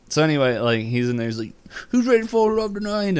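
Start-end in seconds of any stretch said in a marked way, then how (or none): 1.9-3.2 high, loud crowd-rousing call; hyped-up performer. Retold or imitated. Love gun character to a cheering crowd, hyped-up. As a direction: call out to fire up the audience.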